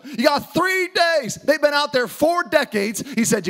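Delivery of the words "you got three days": higher, slow, each word hammered; a leader refusing to wait; emphatic Joshua. Retold or imitated. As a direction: drive home the short deadline. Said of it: "Well, you gotta turn the ship slow".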